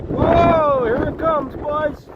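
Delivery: high, startled whoa as the gust hits; startled excited call. Real, not acted. Sudden high whoa, then calling out.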